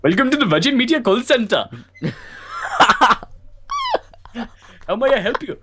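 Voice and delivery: high, hammed-up helpdesk voice with an accent; shrieky put-on tech-support character; screamed call-centre impression. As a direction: screech out a mock call-centre greeting, close to laughing. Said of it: "You just screamed that in my face".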